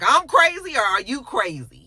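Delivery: loud, high start that drops; retold argument, incredulous and heated; mock angry yell. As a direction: imitate his indignant yelling comeback. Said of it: "He's yelling and screaming at her".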